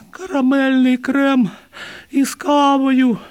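high, loud, drawn-out elf voice; dazed elf. A narrator's reading. Dazed elf answering the doctor about fingers, high dreamy squeal. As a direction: answer the doctor in a squeaky, far-gone singsong.

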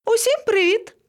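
very high cheery hail; high-pitched monkey greeting; calling from above. A narrator's reading. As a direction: sing out a greeting from a treetop.

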